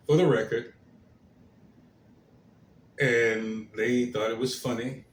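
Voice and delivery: ordinary delivery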